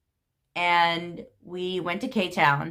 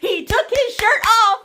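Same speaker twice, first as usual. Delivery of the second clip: much higher, louder, laughing disbelief; excited disbelief; 12 semitones higher, 5 dB louder than usual. Real, not acted. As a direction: shout the shock, barely holding in laughter. Shocked retelling of shirtless man.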